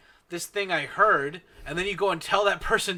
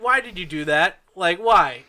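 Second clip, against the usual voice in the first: louder, pleading why; exasperated why; 2 semitones higher, 9 dB louder than usual. Retold or imitated. Asking Victor why he told, exasperated and louder. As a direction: demand an answer in disbelief. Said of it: "I'm just like".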